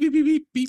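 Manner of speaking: high, loud beeping in a mock bleep; imitated censor beep. Friend jokes about bleeping swears, high loud beeps. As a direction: squeak it out like a censor tone. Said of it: "one out that goes beep".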